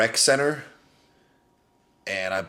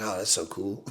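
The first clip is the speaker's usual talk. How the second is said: imitating [name]; 9 dB quieter than usual. [name] quote, soft.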